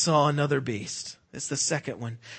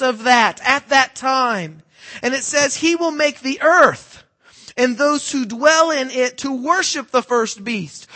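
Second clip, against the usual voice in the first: full-force preaching; 8 semitones higher, 9 dB louder than usual. Acted. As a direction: drive the point home to the congregation.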